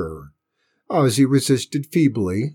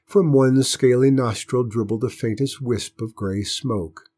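ordinary delivery

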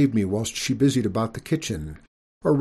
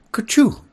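even louder, higher sneeze; 12 semitones higher, 8 dB louder than usual. A narrator's reading. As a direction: sneeze it out hard. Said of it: "said I".

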